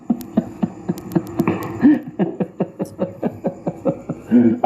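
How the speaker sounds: long, pulsing chuckle; long laugh. Real, not acted. YouTuber laughing after teasing someone, long chuckling laugh. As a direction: laugh on and on in short bursts.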